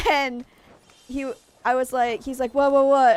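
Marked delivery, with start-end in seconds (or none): 2.5-3.2 louder, startled repeated what; startled husband. Retold or imitated. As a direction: jolt awake and bark the words. Husband woken by a smack.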